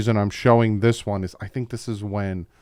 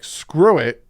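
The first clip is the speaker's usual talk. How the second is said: mock reckless shrug; 6 semitones higher, 9 dB louder than usual. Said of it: "he's like".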